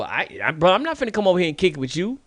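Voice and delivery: normal talking voice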